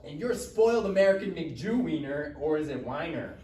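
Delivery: furious shout while pinning him to the wall; angry insult. Acted. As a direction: shove and spit the insult. Wrestling gets heated.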